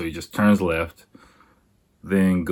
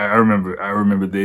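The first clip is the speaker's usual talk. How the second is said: usual speaking voice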